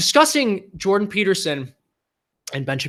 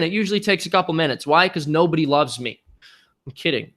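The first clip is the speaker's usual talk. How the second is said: usual speaking voice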